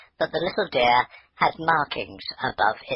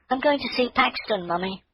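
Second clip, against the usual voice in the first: put-on high, eager child's voice; a girl rushing out to see her deer; eager little girl; 12 semitones higher than usual.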